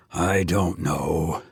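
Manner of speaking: put-on an old priest unsure of himself; quiet gentle old priest; gentle doubt. A narrator's reading. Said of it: "old and wise and gentle".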